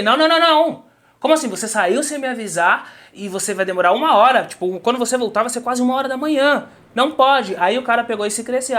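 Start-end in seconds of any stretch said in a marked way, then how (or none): 0.0-7.5 retold outraged refusal, higher and louder; indignant protest. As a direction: push back hard, voice jumping up. (protesto indignado)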